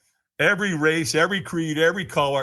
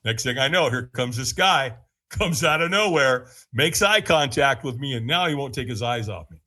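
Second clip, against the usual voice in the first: ordinary delivery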